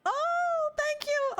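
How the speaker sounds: high squeal of gratitude; overjoyed thanks. Real, not acted. Streamer thanking donor, overjoyed.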